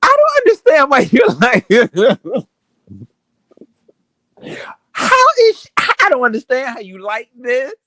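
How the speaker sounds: high, loud, laughing incredulity; laughing disbelief. Real, not acted. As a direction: squeal it through laughter, can't believe it.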